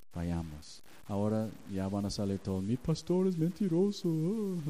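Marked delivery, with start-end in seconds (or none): 2.6-4.7 high, put-on gossip voice; mock tattling congregant. Congregation telling the whole neighborhood on their pastor, mock-scandalized high voice. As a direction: mimic a scandalized tattler in a raised pitch. Acts out congregation spreading the news.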